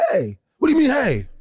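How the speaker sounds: high, baffled mock question; baffled retort. A stranger throwing the greeting back, baffled and high. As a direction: play the confused guy throwing it back. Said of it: "he's like".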